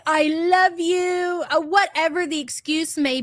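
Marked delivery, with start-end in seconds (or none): 0.1-1.4 higher, slow, pleading mock apology; mock apologetic cheater. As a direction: ham up a sorry cheater, high and slow. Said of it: "it's usually".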